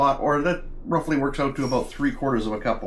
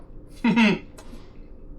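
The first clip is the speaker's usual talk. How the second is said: high breathy laugh; 9 semitones higher than usual. Real, not acted. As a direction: giggle in a high airy burst. Laughs after exclaiming while tasting.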